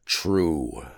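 sighing, low, soft admission; unhappy. A narrator's reading. Thor admitting his carelessness lost the hammer, unhappy. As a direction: concede it with a heavy sigh. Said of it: "said Thor unhappily".

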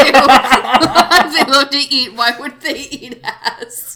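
words squeezed through laughter; slow, breaking into laughs; laughing while talking. Real, not acted. Repeating friend's joke while cracking up.

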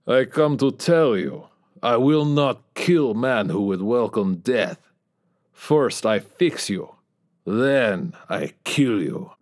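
put-on slow, heavy Russian accent with a cold threat; Ivor's Russian accent. A narrator's reading. Ivor tells dying Bob he will fix then kill him, slow Russian menace. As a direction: promise death slowly, in thick Russian.

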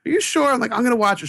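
put-on high, cocky little-kid voice; defiant kid. Kid talking back to mom, high and cocky.

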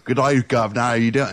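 mock British greeting with a mouth full of balls; garbled, hammed-up British accent. Mocking British talk to a co-host, mouth-full British accent. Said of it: "British people... spit the balls out of their mouths".

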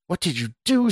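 high, anguished question; distraught cry. A narrator's reading. Scott demands answers from the vanished stranger, crying out. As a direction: cry it out in high-pitched horror. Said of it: "Scott cried".

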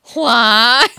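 loud strangled squawk; choked comic bird cry; strangled hybrid owl call. Retold or imitated. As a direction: squawk like two owls being strangled. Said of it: "sounds like it's the two of them being strangled".

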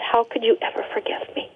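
hushed, nearly voiceless; broken whisper. Retold or imitated. Father crumbling on the ground asks his daughter, whispering. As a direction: whisper it as if the voice has gone from sobbing.

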